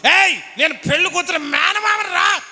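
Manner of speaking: high, loud quarrelsome shout; drunken uncle yelling. Retold or imitated. Bride's drunk uncle yelling at the groom's uncle. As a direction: bark it back like a drunk uncle picking a fight.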